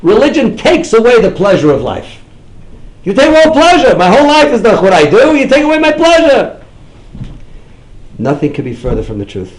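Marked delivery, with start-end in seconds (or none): 0.0-2.2 loud, high scoffing voice; mocking skeptic. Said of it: "they'll say". 3.0-6.6 loud, high outraged whine; hammed-up indignation; mock indignant protest. Repeating quote louder, higher.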